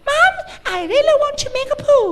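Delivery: put-on very high little-kid English; squeaky, slow plea to mum; Western child. Foreign child telling mum about needing the toilet, high childish voice. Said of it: "เด็กฝรั่งก็บอก".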